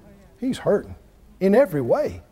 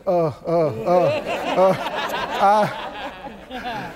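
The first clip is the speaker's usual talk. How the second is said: low, laughing stammer; sheepish Job; 4 semitones lower than usual. Acted. Preacher voicing humbled Job before God, lower and laughing. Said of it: "Job said".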